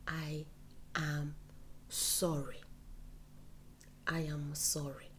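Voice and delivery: each word spaced and whispered; soft drilled apology; slow whispered apology. Shown as a demonstration. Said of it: "have it at the tip of your tongue".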